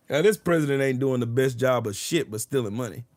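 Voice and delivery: usual speaking voice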